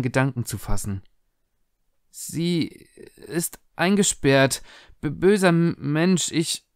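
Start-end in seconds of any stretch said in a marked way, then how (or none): 2.1-6.7 higher, broken, halting words; a girl in shock, stuttering; tearful stammer. A narrator's reading. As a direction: stammer through tears, barely able to speak. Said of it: "stammelte sie".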